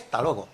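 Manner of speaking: soft, low "hasta luego" shrunk to "talogo"; everyday "hasta luego", no "has", no diphthong; reduced form. Shown as a demonstration. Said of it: "que es lo que se dice".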